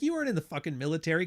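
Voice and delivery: rattled off fast and scornful; quick scornful retort; fast scoff. Retold or imitated. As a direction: fire it back quickly in disbelief. Retorting to the poser.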